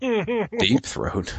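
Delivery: incredulous. Real, not acted. Reacting to the caller's name.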